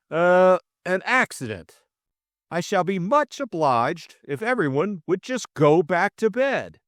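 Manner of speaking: high-pitched, fussy request; flustered officiousness. A narrator's reading. George dismissing the reluctant household, flustered. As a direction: seize on the word, then insist in a high voice.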